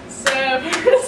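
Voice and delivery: ordinary delivery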